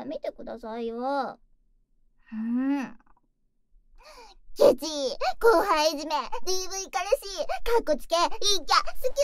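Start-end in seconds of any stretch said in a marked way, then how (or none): 4.6-9.2 loud, high playful name-calling; teasing shout. Acted. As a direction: yell teasing names, end with a squeal. Teasing insults then confession.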